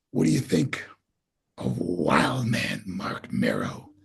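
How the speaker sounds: very low, slow, booming-boss impression; Vince. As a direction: drop deep and draw out the pitch. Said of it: "Vince goes".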